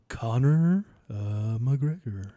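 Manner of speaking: low, slow, drawn-out late-night DJ; hushed mock midnight radio announcer. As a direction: croon the name like a late-night radio host. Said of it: "midnight radio talk".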